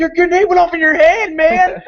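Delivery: high, loud exclamation; hammed-up shout of alarm at a mishap; mock alarmed yell. Retold yell over popped balloon.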